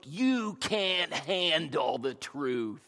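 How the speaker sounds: slow, weighty movie-star delivery, a light imitation; Jack Nicholson's line. Preacher quoting the famous courtroom line, slow and weighty. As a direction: lean into each word like the film. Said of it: "you can just see it, his, his look".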